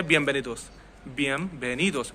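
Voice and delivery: बिएनवेनिदोस said as biembenidos, n closed to m; n becomes m. Shown as a demonstration. Teacher shows the native Spanish way, n becomes m. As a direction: soften the n of बिएनवेनिदोस into m. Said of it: "बिएनवेनिदोस नहीं बोल रहा हूँ".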